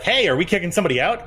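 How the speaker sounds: a cop who can't wait to throw someone out; eager cop. Retold or imitated. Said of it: "and be like".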